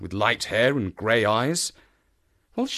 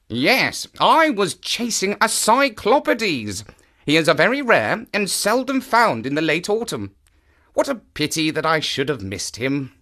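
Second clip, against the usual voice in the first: lightly voiced chatty naturalist, higher; unconcerned; 6 semitones higher than usual. A narrator's reading. Brother explains his chase to sister, unconcernedly. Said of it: "He spoke unconcernedly".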